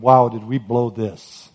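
like smacking your own forehead, lightly acted; slap on the forehead. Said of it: "it's like a slap on the forehead".

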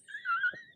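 very high-pitched giggle; squealing laugh. Real, not acted. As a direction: let out a short shriek of laughter. Laughs hoping subject won't watch.

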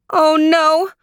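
high, loud dismayed moan. Acted. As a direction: wail it like a princess. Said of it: "she moaned".

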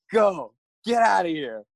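retold exasperated order; fed-up dismissal. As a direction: chase him away impatiently. Retold order sending the attacker off.

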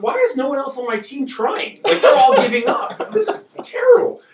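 high and loud, laughing exasperated rant; mock exasperation. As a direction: rant half-laughing about your lazy teammates. Retelling frustration with teammates.

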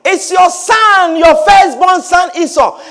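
loud, high, slow plea of a son; Esau pleading. Acted. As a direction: call out eagerly to your father. Esau voiced in retold story.

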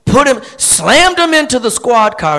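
ordinary talk, nothing marked

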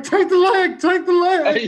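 high, loud frantic yelling of the order; panicked shout. Retold or imitated. Retelling the alien movie scene to friends, a frantic high shout. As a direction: shout it like a man whose leg is being taken over.

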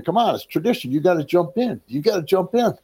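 high-pitched mock shouting from the dock; retold yelling. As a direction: call them in like the boys did, high and pushy. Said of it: "they're screaming".

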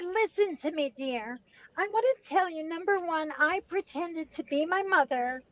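high put-on woman's voice; mom impersonation. Teen confessing in the voice he used as mom, high. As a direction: talk way up high like a fake mother. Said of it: "I started doing the voice".

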